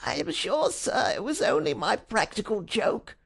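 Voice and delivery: high, thin pleading whimper. A narrator's reading. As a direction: wheedle like a cowering culprit. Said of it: "The wretched creature began to whimper".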